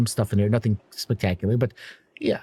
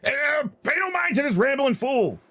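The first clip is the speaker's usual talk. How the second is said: much higher-pitched, dismissive character voice; irritated dwarf. Acted. Dwarf dismisses the half-elf to the crew, irritated. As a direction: brush her off in a high dwarf voice.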